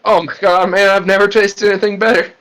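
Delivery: high, overjoyed praise; high ecstatic voice. Acted. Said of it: "Graham is just like".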